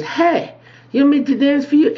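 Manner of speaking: very high put-on girl voice; dancer's come-on. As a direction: pitch it way up, quick, as a dancer offering herself.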